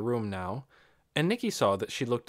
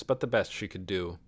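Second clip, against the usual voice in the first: usual speaking voice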